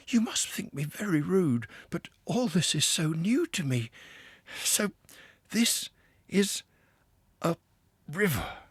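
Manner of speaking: a newcomer seeing the river for the first time; Mole, awed and a little breathless; wide-eyed Mole. A narrator's reading.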